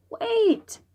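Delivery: high calling cry; girl's cry. Acted. Xingling stopping the monster, high cry. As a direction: call out high to stop him.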